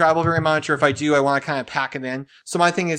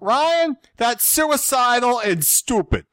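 much higher, slow, hammed-up gangster scolding; mock New York thug; 9 semitones higher, much slower than usual. Husband mimicking Jen's warning about Comic-Con, mock thug voice. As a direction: drag it out like a mafia heavy. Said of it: "Jen has turned into, like, thug".

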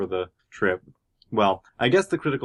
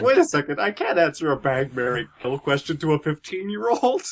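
much higher, flustered mock alarm; 6 semitones higher, 3 dB louder than usual. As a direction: play a panicked celebrity catching himself. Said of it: "And then he's like".

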